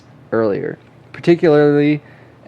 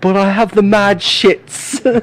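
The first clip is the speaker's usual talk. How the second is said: slow, higher mock British kid voice; mock British accent; 8 semitones higher, slower than usual. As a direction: drawl it out like a cheeky British schoolkid. Repeating kid's line in accent.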